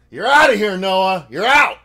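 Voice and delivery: loud playful ejection call; umpire call. Pretend. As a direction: bellow it like an umpire. Mock ejecting chatter like umpire.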